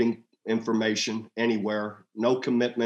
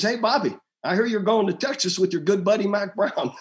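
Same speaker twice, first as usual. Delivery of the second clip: pitched up, playful ribbing; teasing coach; 9 semitones higher than usual. Retold or imitated. Coach Spurrier teasing Bobby on the phone. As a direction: needle him about the rumor. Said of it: "He says".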